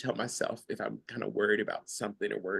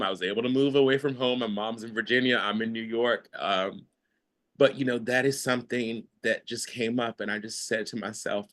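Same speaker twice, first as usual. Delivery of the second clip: ordinary talk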